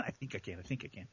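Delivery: very low, hushed little chant; soft, low repeated mantra; quiet self-encouragement. Real, not acted. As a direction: murmur a pep talk to yourself. Self pep-talk after louder tries.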